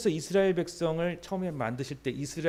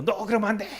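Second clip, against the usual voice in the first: loud, higher snap of a telling-off; mock scolding; 5 semitones higher, 4 dB louder than usual. Child scolding friends at church, mock sharp telling-off.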